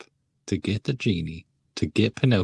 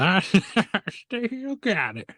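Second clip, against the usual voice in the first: high, slow gloating "still got it"; proud old hunter; 10 semitones higher, slower than usual. Retold or imitated. Whistler boasting after the kill, slow and high. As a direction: drag it out like a proud veteran. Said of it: "Whistler is like".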